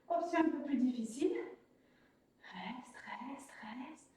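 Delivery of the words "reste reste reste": soft whispered urging; whispering. Real, not acted. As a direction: breathe the words almost silently. Coach urging hold, whispered.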